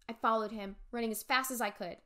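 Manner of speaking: usual speaking voice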